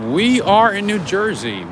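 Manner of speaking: high, loud proclamation; excited announcement. Real, not acted. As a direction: announce it big to returning viewers. Announcing location after stream reconnects.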